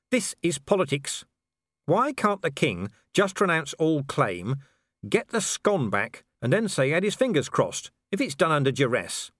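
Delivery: blunt investigator Vimes. Acted. Investigator questions official about the king's claim, blunt. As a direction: argue the pragmatic way out.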